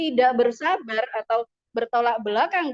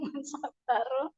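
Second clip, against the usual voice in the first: man sabaro slow, Javanese a, through a laugh; laughing Javanese mock; 4 dB quieter, slower than usual. Shown as a demonstration. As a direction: tease the Javanese accent while laughing. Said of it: "Bahasa Jawa dong".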